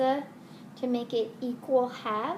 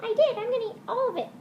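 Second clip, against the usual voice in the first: high puppet voice; 10 semitones higher, much faster than usual. Acted. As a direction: play a greedy little puppet, high and bright.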